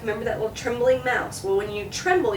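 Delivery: ordinary talk, nothing marked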